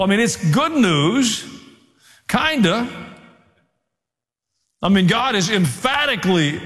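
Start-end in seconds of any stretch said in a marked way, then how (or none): 0.0-2.9 higher, slowed, wry hedging; wry aside. Real, not acted. Preacher to congregation, wryly hedging the good news. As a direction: hedge it with a wry lift. Wry hedge on God's promise.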